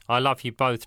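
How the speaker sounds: ordinary delivery, nothing marked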